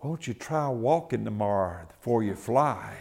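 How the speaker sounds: low, soft gentle question; quiet divine reply. Retold or imitated. God gently answering the preacher, low and soft.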